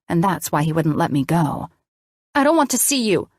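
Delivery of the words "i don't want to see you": high, sharp angry bark. A narrator's reading. Daughter barking at her mother, high and angry. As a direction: push her away with it. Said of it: "I barked".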